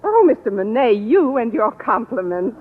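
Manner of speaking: ordinary delivery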